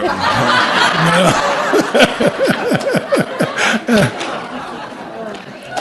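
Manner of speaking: the speaker cracks up at the end of his joke, laughing; real laugh at own joke. Real, not acted. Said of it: "laughs at own punchline".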